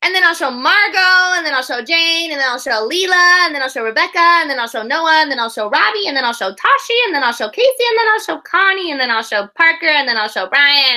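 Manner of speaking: put-on child bursting to share; excited child voice. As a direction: rattle off names like a thrilled preschooler. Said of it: "she's talking to her dad".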